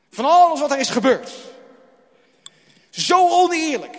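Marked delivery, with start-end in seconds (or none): 2.9-4.0 anguished cry. Acted. Preaching about anger.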